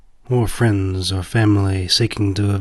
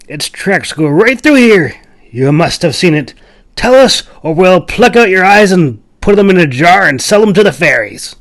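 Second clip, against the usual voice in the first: much higher and louder menacing threat; angry threat; 8 semitones higher, 9 dB louder than usual. Acted. Bearded leader threatens to pluck out the stranger's eyes, furious. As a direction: snarl through gnashing teeth. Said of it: "gnashed his teeth".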